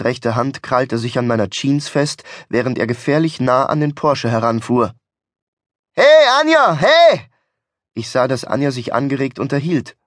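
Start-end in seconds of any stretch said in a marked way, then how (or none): 5.9-7.3 high, loud hailing shout; calling out. Acted. Passenger on the window ledge hollers to Anja, desperate calling. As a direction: holler to be heard in another car. Calling to woman in other car.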